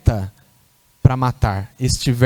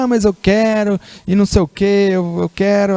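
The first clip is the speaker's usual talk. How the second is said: high, whiny voice of an insisting man; mock the pastor begging for ice cream; 6 semitones higher than usual. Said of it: "E ele vai lá e insiste, fala".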